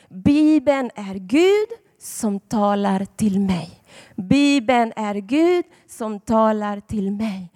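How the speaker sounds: put-on slow, deliberate words for children to repeat; slow teaching recital. Shown as a demonstration. Preacher teaching children a slow, deliberate recital. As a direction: recite slowly so the kids can follow. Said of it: "peka på Bibeln och säg".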